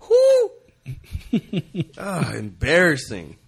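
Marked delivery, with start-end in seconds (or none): 0.1-0.5 high, loud sarcastic whoop; mock cheering whoo. Host mocking his team's halftime band with a whoop. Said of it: "I was like".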